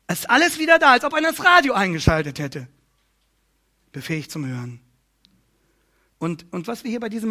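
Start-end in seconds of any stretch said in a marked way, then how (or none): 0.1-2.7 excited burst. Acted. Preacher imagines hearing restored.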